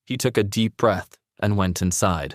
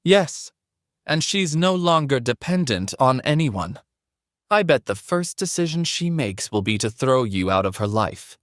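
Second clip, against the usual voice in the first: higher, mocking mother-in-law voice; haughty older woman; 5 semitones higher than usual. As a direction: play a smug mother-in-law. Sandra gloats.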